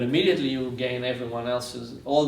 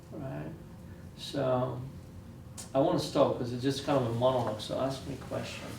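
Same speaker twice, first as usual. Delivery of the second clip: ordinary talk, nothing marked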